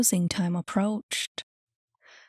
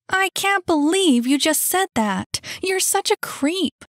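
high-pitched, loud and appalled; girl recoiling in indignation; outraged Annie; 10 semitones higher, 4 dB louder than usual. Acted.

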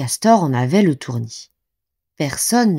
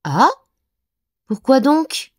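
high rising puzzled why; genuinely baffled; surprised question; 8 semitones higher, slower than usual. A narrator's reading. Castor ne comprend pas pourquoi on le rejette, étonné. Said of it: "s'étonna Castor".

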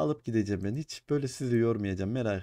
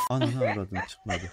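normal talking voice

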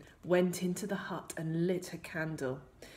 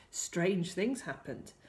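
usual speaking voice

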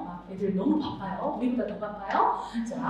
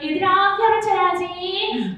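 put-on higher, sing-song call to kids; bright high pitch; 6 semitones higher, 5 dB louder, slower than usual. Shown as a demonstration. Teacher demoing a higher, sing-song call to children. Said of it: "아이들 상대할 때는 솔".

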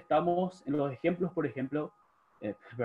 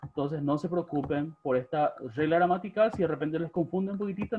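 normal talking voice

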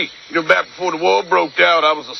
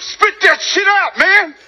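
angry shout; slower than usual. Acted. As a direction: force the words out at him. Demanding an answer, furious.

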